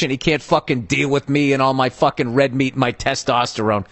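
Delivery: normal talking voice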